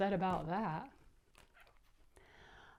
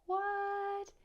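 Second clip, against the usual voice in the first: surprise; 11 semitones higher than usual. Real, not acted. Reader's astonished reaction.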